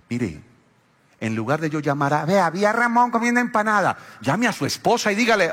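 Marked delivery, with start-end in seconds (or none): 2.2-4.0 mock tattletale. Gossip tattling on Ramón.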